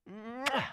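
exaggerated high smooch; kiss sound. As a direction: imitate a big "mwah" kiss. Imitates kissing the chicken.

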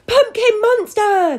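very high, loud, stretched scream; panicked cry. A narrator's reading. Townspeople run for their lives, screaming. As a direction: cry the alarm like a fleeing crowd. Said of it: "they cried".

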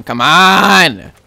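usual speaking voice

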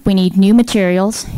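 usual speaking voice

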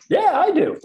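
high, loud answer across the room; calling back. Retold or imitated. Employee answering a coworker thirty feet away, calling back.

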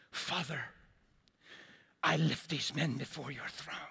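mock prayer-meeting voice, lightly put on; a hint of a formal pleading prayer tone; intercession voice. Pastor mimics his own old prayer to God, intercession voice. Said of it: "put on my intercession voice".

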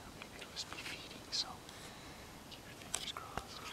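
barely audible hunter whisper. Real, not acted. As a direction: whisper while stalking close. Stalking a bear, whispering.

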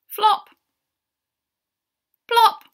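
high, loud single pops; comic sound words. A narrator's reading. Narrator voicing llamas landing in mud, comic. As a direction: pop each word out high, like a splat.

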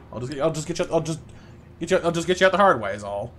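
ordinary talk, nothing marked

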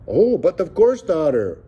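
high, loud theatrical flourish; stage-actor airs. A narrator's reading. Joaquin playing along with his supposed daughter, stage-actor airs. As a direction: answer grandly like a stage actor. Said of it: "all the grace and airs of a stage actor".

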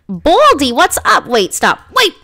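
high, loud call to someone leaving. Pretend. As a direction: holler to catch them, starting high and loud. Calls out to passing character.

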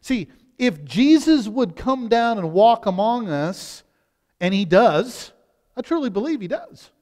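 ordinary talk, nothing marked

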